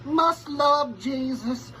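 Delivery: "Must love Jesus" sung out slow and very high; high-pitched repeat. Pretend. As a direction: leap up high and stretch every word. Parody suitor repeats it much higher.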